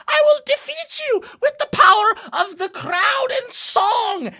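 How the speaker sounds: high, ringing stage declaration; heroic imp actor. Acted. GM as Mira imp challenging the fiend, grand declamation. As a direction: proclaim it in a squeaky, hammy hero voice. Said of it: "And they will say".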